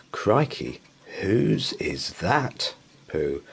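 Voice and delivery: plain reading voice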